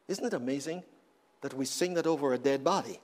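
ordinary talk, nothing marked

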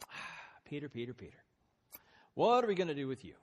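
loud, fast tut-tutting rebuke; hammed-up parental exasperation; exasperated scolding. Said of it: "I don't think he went".

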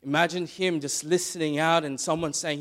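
ordinary delivery

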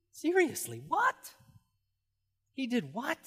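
high, incredulous "what?"; astonished disbelief. Acted. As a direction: sound utterly amazed at the idea.

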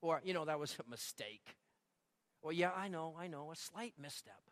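mimicking casual half-confessions; mock excuses. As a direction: shrug off the wrongdoing lightly. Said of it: "phrases you commonly hear".

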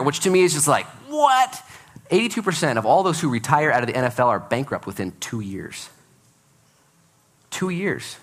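ordinary talk, nothing marked

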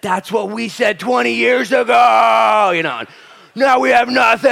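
high, loud, slow warning shout; alarmed elders. Retold or imitated. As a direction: warn the kids with wide eyes. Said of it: "wide eyes said".

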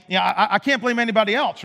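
ordinary delivery

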